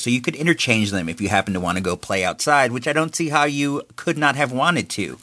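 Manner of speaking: ordinary talk, nothing marked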